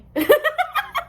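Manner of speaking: high-pitched giggling burst; laugh. Real, not acted. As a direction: burst out laughing at your own story. Laughing at her own story.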